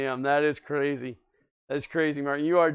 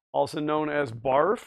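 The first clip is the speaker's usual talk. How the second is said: deadpan punchline. Real, not acted. Punchline acronym.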